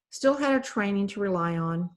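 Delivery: usual speaking voice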